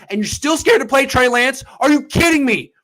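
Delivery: loud, high, fired-up yelling; all-out furious sports rant; angry rant. Real, not acted. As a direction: blast the team for being cowards. Ranting at the Niners.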